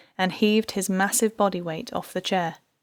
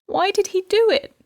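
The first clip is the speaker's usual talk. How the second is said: distraught; 12 semitones higher, 6 dB louder than usual. A narrator's reading. Wife alone in shock.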